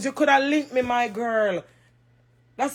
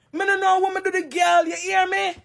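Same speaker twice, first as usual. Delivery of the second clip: higher, insistent repeat of the protest; upset protest; 5 semitones higher than usual. Real, not acted. Woman insisting to viewers she did nothing, upset. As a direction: push it up high, demand to be heard.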